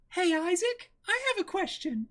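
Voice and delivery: put-on high, sweet anime girl; a squeaky, high girl voice. Voicing Miria.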